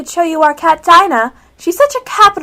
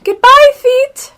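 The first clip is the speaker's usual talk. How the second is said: slow, higher called farewell; calling goodbye; 7 semitones higher, 4 dB louder, slower than usual. Acted. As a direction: call it out slowly, louder. Alice growing huge, calls farewell.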